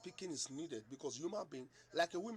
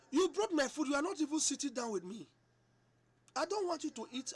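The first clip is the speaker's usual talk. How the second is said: delighted call; 10 semitones higher, 9 dB louder than usual. Retold or imitated. Husband pleased, calling wife to sit.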